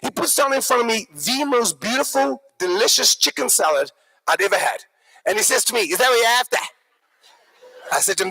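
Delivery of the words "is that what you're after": Aussie waiter checking back; questioning Australian twang; broad Australian accent. Acted. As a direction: ask like an Australian bogan. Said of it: "very broad Australian accent".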